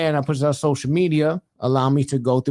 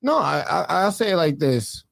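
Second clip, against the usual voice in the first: usual speaking voice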